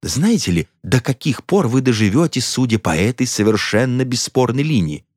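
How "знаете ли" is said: ordinary delivery, nothing marked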